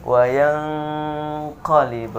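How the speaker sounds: wayang qalib slow with the ng hummed long; correct slow nasal hold. Shown as a demonstration. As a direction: draw out the hum in wayang. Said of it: "Sekali lagi". (wayang qalib pelan dengan dengung panjang)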